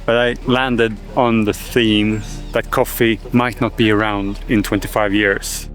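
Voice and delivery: normal talking voice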